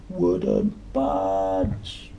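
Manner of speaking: put-on storyteller teasing kids with the refrain; high whispered refrain. A narrator's reading. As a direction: squeak it out in a whisper. Climax of refrain.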